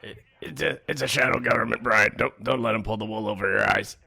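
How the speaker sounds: conspiracy theorist voice. Pretend. Conspiracy voice about shadow government.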